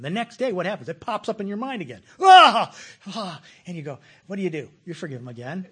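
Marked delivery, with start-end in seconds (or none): 2.2-2.7 loud, high cry of frustration; angry cry. Acted. The hurt returns to the listener, a loud, high, exasperated cry. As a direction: let out a sudden burst of exasperation. Preacher acting out sudden anger.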